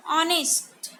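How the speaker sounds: ऑनेस्ट said with no h, starting on the "o"; silent h. Shown as a demonstration. Teacher showing students that ऑनेस्ट has a silent h. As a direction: drop the h and open on the vowel in ऑनेस्ट. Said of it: "इसे हॉनेस्ट read नहीं करते हैं".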